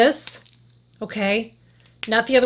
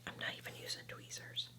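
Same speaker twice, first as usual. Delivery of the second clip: unvoiced whisper, much softer; 22 dB quieter than usual. Real, not acted. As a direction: drop to a whisper as if sharing a secret. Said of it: "I don't know why I'm whispering".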